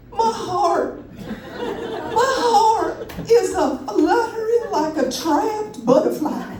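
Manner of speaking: slow, high, melodramatic Southern drawl. Acted. Passenger telling flight attendant about her heart, hammed-up Southern drawl. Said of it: "speaking in a Southern drawl".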